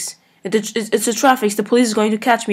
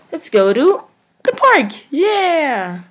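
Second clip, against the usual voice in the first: excited cheer; 6 semitones higher, much slower than usual. Real, not acted. Cheering for the park choice.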